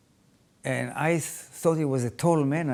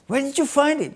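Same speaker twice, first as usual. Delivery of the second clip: surprised; 11 semitones higher, 4 dB louder than usual. Retold or imitated. Mother sees photo of father.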